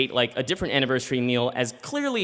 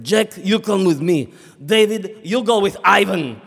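louder mock Russian orders; Russian accent; 3 semitones higher, 6 dB louder than usual. Attendant assigning Jack and David to masseurs, Russian accent.

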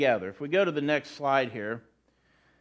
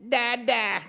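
imitating a baby's first word, higher; a light baby voice, higher; baby talk; 6 semitones higher than usual. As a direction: coo it like an infant saying Dada. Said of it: "Little babies go".

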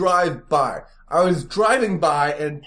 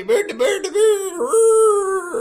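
mock bird cry; 3 dB louder, much slower than usual. Mocking cry in pitch.

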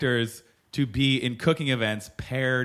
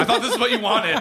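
put-on Italian accent; 6 semitones higher than usual. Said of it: "doing an Italian accent".

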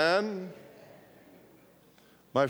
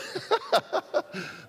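high laugh. Real, not acted. Laughing at own slip.

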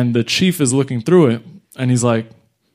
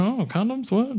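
mock surprise; 11 semitones higher, 6 dB quieter than usual. Fake surprise finding condoms.

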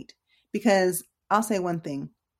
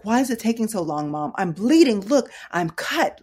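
raised, higher-pitched imitation of a complaint; upset son; 4 semitones higher, 5 dB louder than usual. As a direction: mimic an upset son protesting to mom. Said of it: "never seen my son this upset".

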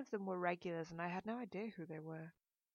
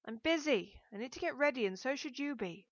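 raised, higher, brisk refusal; irritated call back; 6 semitones higher, 8 dB louder than usual. A narrator's reading. Busy daughter answers mother through the door, irritated. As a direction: put them off testily, loudly.

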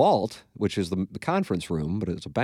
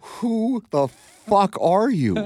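mock disgusted yell; 8 semitones higher, much slower than usual. Cringing at his own old post, slow high mock outrage. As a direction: stretch it out, appalled at yourself. Scoffing at own past self.

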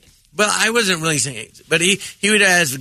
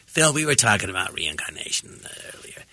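Harvey Fierstein impression; 9 semitones lower, 5 dB quieter than usual. Comic imitating Harvey Fierstein asking a guest, low-voiced. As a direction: lower voice, like a talk show host asking his guests. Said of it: "It's a really good impression of Harvey Firestein".

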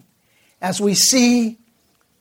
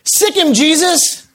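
higher-pitched mock old-lady exclamation; exclaimed command; 6 semitones higher than usual. Woman setting her Doberman on the intruder, mock exclaiming. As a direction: call out the attack order in a raised pitch. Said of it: "heard the woman exclaim".

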